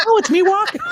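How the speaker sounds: very high imitation of the girl, laughing; high-pitched mock female voice; mock girl's reply. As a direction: squeak out her retort through laughter. Acts out girl's reply amid laughter.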